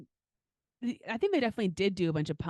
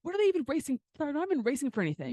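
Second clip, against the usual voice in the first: whiny; 7 semitones higher, faster than usual. Retold or imitated. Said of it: "petty and whiny".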